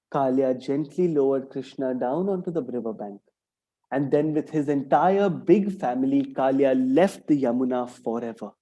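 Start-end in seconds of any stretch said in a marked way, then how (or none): none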